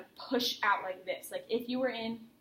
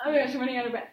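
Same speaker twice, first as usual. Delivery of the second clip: mock panic; 2 semitones higher, 7 dB louder, much faster than usual. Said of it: "you're like".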